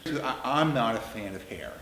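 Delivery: ordinary talk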